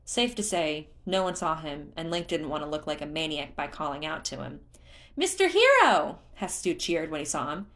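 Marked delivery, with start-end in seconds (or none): 5.2-6.2 very high, loud cheer; cheerful creature. A narrator's reading. Hestu spotting Link, cheering in a very high voice. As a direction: squeal with glee at a friend.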